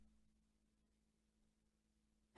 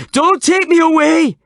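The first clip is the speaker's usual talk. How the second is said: very high, loud panicked wail; 10 dB louder than usual. A narrator's reading. Old man panicking at the police, wailing. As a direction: wail in terror. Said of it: "wailed the old man".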